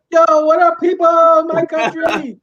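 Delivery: imagined president greeting the country street style; mock president greeting. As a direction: hail the whole nation like a buddy. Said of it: "I thought maybe he would do that".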